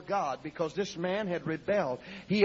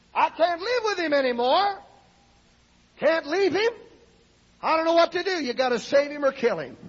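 high, loud imitation of a wife's pleading; desperate prayer; 8 semitones higher, 6 dB louder than usual. Wife who can't live with him begging God, loud desperate cry. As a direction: cry it out at the end of your rope. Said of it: "finally she got so desperate".

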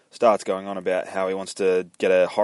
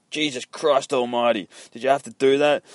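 raised, louder exasperated outcry; loud protest; 6 semitones higher, 5 dB louder than usual. Acted. Beaten dude objecting to Finch, loud high protest. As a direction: protest loudly with pitch pushed up.

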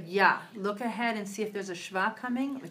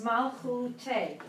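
slow model; 2 semitones higher than usual. Shown as a demonstration.

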